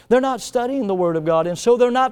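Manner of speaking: building from firm to loud and high; preaching build. Real, not acted. Preacher warning the church, building to a loud cry.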